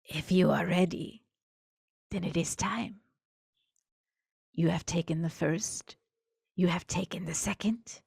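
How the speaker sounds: a lightly voiced tinny, guttural monster; tinny guttural creature. Monster granting Fernando the third step, tinny and guttural. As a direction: speak like a thing wearing a human face. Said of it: "tinny yet guttural voice".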